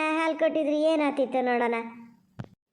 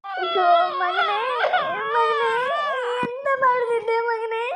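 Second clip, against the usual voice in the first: loud, higher wailing lament; crying wail; 7 semitones higher, 5 dB louder than usual. Real, not acted. Mother wailing over what happened to her son, loud and crying. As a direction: cry out to him in grief.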